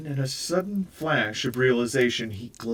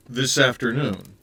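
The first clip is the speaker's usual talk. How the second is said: louder, bewildered echo with a falling end; confused echo, a touch louder; echoing, at a loss; 2 semitones higher, 6 dB louder than usual. Acted. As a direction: repeat it back, lost for an answer. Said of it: "clearly at a loss".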